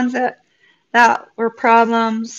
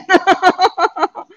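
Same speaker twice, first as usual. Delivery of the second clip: high, louder laugh; a real laugh, pitched up; 9 semitones higher, 5 dB louder than usual. Real, not acted. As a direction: giggle at your own confession. Laughing about her twin.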